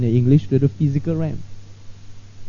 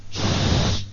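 long "shh" hush; sustained hissing shush; 5 dB quieter than usual. Acted. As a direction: hush a room with a drawn-out shh. Demonstrates hushing people.

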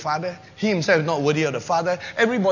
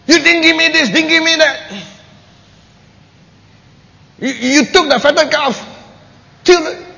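loud, higher, aggrieved complaint; angry elder son; 7 semitones higher, 6 dB louder than usual. Acted. Elder son complains to the father, loud and aggrieved. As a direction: rant about the fatted calf. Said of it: "Angry says".

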